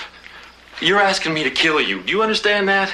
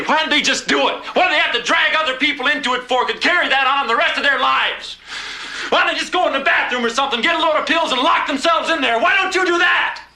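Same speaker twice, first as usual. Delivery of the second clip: anguished outburst; 10 semitones higher than usual. Acted. Wife's anguished outburst at dying husband.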